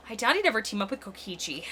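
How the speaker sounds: usual speaking voice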